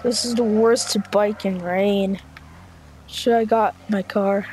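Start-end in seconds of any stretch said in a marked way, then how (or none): none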